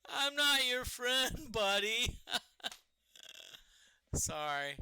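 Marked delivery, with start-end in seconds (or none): 0.1-2.2 high, loud mock-indignant comedy voice, slowing on the second line; cartoonish high outrage; South Park quote. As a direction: bristle like the cartoon bit, dragging it out. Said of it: "It reminds me of the South Park".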